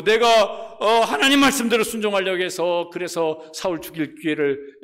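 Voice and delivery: impassioned acted complaint to God; anguished protest. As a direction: plead loudly, dragging out the words. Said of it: "다윗의 마음속에는".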